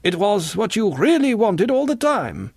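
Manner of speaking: high, pressing character voice; high-pitched insistence; insistent singer. A narrator's reading.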